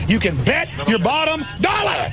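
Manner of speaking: furious shouted rant. Real, not acted. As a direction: rail at them, pitch climbing. Ranting against control freaks.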